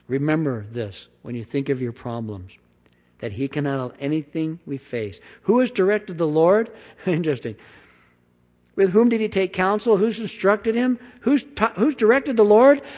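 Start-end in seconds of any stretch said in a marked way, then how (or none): 5.4-12.8 rising higher and louder toward "Lord"; from raised to much higher and louder by the end; building rhetorical questions. Acted. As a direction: stack the questions with mounting awe.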